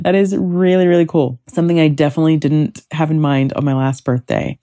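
usual speaking voice

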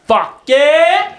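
high, loud fuck yeah; excited shout. Real, not acted. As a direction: cheer it out. Hyped about Deadpool news.

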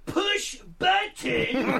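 high, drawn-out raspy shout; Larry. Retold or imitated. Nurse instructs a patient with no English, slow raspy shouting. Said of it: "say the language that you speak slower and harder".

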